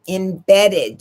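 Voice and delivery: Embedded pressed firmly; teacher hammering the word Embedded; stressing embedded. Shown as a demonstration. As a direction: lean on Embedded to drive it home. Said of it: "do you hear me?".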